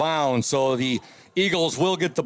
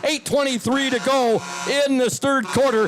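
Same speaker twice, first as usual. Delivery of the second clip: announcer; 7 semitones higher than usual. Real, not acted. Broadcast station ID read.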